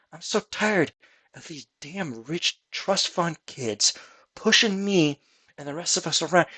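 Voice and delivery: overworked library elf complaining to himself; grumbling elf clerk. Acted. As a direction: mutter bitterly about overtime. Elf grumbles about overtime.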